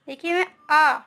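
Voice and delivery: usual speaking voice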